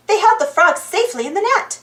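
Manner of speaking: plain reading voice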